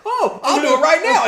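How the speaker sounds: high, loud melodramatic threat; mock defiant outburst. Boyfriend dares himself before girlfriend, mock defiant yell. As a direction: throw it out as a wild dare.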